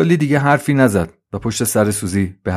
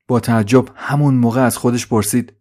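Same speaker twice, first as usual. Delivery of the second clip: ordinary delivery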